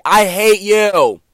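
loud, slow, high yell through the wall; drawn-out real yell at a cousin. Real, not acted. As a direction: shout at the annoying cousin.

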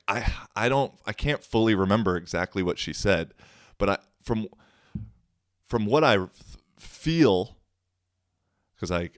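normal talking voice

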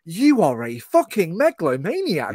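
slow, high, loud disbelief; incredulous emphatic condemnation; exasperated outrage. Real, not acted. Exasperated reaction to the bully teacher.

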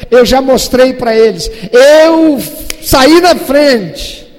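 loud, high, fervent pulpit delivery; loud sermon voice, ringing high; full-force preaching. Acted. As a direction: preach it out to the congregation.

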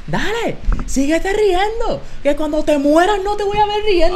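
high, slow sneering taunt; mocking janitor voice. Janitor threatening the laughing students, sneering imitation. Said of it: "el tipo".

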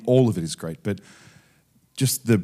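usual speaking voice

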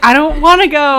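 put-on high, loud child protest; whining kid digging in; little kid voice. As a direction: refuse like a balking kid. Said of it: "a little kid voice in your head".

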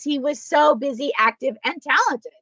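normal talking voice

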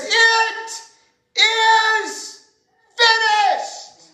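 high, loud, drawn-out cry with long pauses. Acted. Jesus crying out from the cross, loud and high. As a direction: cry it out to the heavens, word by word. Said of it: "he cries with a loud voice".

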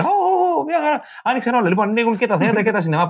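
high, sarcastic delight; mock delight; mock cheer. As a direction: fake celebration, high and sing-song. Mocking cheer over case numbers.

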